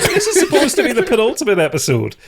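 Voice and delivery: high, loud squeal through laughter; laughing exasperation. Real, not acted. Player bemoaning the derailed penultimate episode, laughing exasperation. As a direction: protest while cracking up.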